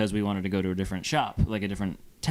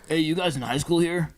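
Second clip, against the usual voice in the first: higher-pitched, lightly voiced scraggly stranger; a hint of a sketchy dude, pitched up; imitated scraggly dude; 6 semitones higher than usual. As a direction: lightly mimic a scraggly guy's question, pitched up. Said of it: "Some scraggly dude in a ball cap saying".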